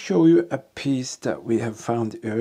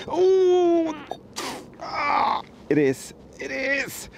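yelp from the cold; much slower than usual. Real, not acted. Freezing hands hauling up plate.

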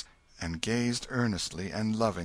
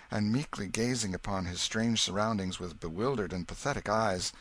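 usual speaking voice

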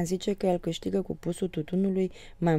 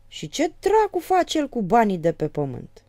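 higher, louder question; amazed; 6 semitones higher, 7 dB louder than usual. A narrator's reading. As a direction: ask it astonished. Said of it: "se miră".

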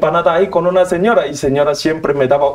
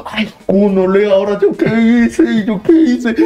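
panicked worry; 4 semitones higher than usual. Retold or imitated. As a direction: wail in alarm at yourself. Panic after knocking robber down.

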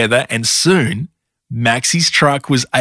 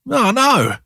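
high-pitched puppy dismay; dismayed cry; 9 semitones higher, slower than usual. A narrator's reading. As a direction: cry out in storybook distress, without shouting. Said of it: "cried Maxie".

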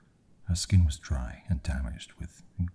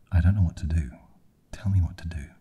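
loud, distressed plea; pleading; 3 semitones higher, 9 dB louder, faster than usual. A narrator's reading. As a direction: plead helplessly, voice raised. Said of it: "she pleaded".

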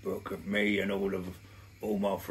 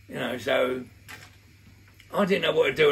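ordinary delivery

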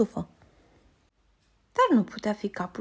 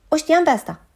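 higher and louder, proud he knows it; excited exclamation; 7 semitones higher, 8 dB louder than usual. A narrator's reading. Old bear proudly tells the little angel, excitedly. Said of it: "exclamă ursul bătrân".

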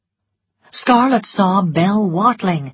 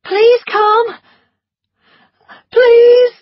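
high, louder pleading cry; desperate plea; 9 dB louder, much slower than usual. A narrator's reading. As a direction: beg in pain from upstairs. Melanie in labour calling.

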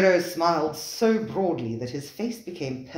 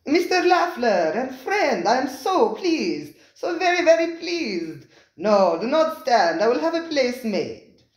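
effusive host; 10 semitones higher, 6 dB louder than usual. Acted. Spiro the restaurateur welcoming his diners, high and effusive. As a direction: gush over the guests like a fawning host.

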